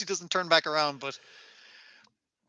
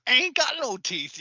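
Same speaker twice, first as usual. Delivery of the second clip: a much higher mock old-lady voice; hammed-up toothless old woman; 9 semitones higher than usual. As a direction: squeak it out like a gummy granny. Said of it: "She's like".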